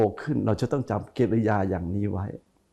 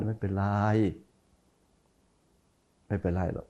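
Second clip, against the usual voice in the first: softer, lower kind reassurance; soft forgiving; 3 semitones lower, 7 dB quieter than usual. Shown as a demonstration. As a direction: say it gently as if truly forgiving. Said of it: "เสียงมันต่างกัน". (พูดเบาๆ อย่างให้อภัยจริงใจ)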